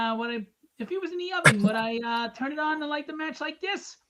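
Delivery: a goofy character asking innocently; silly mock voice. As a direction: rattle it off in a goofy put-on voice.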